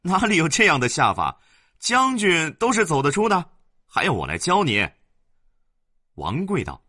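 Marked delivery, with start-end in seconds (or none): 0.0-4.9 amused servant. Acted. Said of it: "王安笑道".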